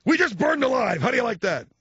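high, loud sneer; mock sarcastic scolding. Father rubbing in the crash to his son, high sarcasm.

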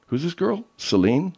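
ordinary talk

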